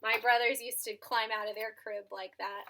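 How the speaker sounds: ordinary delivery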